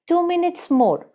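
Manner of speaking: put-on high small child voice; sweet child's plea. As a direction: plead sweetly like a little boy. Child's sweet plea, mother's retort.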